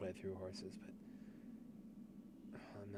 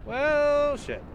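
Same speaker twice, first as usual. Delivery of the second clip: loud sudden curse; frustrated shout; 19 dB louder than usual. Real, not acted. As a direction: blurt it out in frustration. Reacts to failing.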